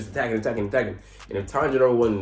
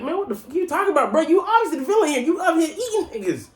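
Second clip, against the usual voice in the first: much higher, heated comeback to the demon; outraged retort. Retold or imitated. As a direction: fire back in disbelief, pitched way up. Tanjiro's outraged retort, voiced.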